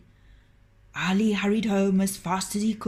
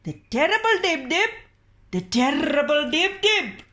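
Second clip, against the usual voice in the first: high mocking crone; old woman; 8 semitones higher, 5 dB louder than usual. The old woman repeats the illness name to the doctor, mocking. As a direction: echo his words back mockingly, high.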